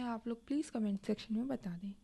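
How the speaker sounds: breathy whispered request; soft whispered aside to students. Real, not acted. Teacher asks for comments.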